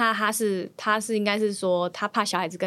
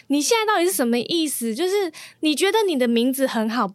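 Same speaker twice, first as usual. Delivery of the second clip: retold angry scolding, raised pitch; 生气地训人; 5 semitones higher than usual. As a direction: scold in exasperation, building higher to the end. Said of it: "然后他就很生气". (模仿他生气地训斥)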